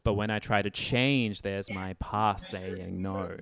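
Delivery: "change", "past" and "no" hit on the beat, slow; anchor the strong words. Shown as a demonstration. Teacher showing strong words landing on strong beats. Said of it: "we start to get change past no".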